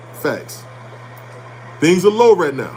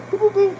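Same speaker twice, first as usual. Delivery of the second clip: mock beeping; 14 semitones higher than usual. Acting out logging in at bank.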